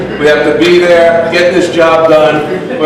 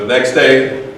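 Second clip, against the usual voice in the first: normal talking voice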